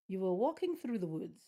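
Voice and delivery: ordinary talk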